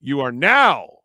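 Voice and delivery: drawn-out showman's announcer sign-off. Acted. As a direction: close the show slowly, stretching out the last words. Show sign-off in announcer style.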